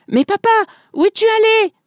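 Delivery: voix d'enfant très aiguë; enfants qui crient. A narrator's reading. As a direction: crie comme un petit enfant inquiet. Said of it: "en criant". (cri aigu d'enfant)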